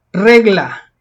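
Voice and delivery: regla with REG punched loud; stress on first syllable. Shown as a demonstration. Teacher presenting regla for classifying, stressed first syllable. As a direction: hit the start of regla, let -la fall. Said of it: "es una palabra grave".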